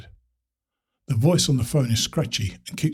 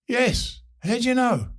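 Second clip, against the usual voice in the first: startled phone voice; 11 semitones higher than usual. Acted. Startled caller on bad line.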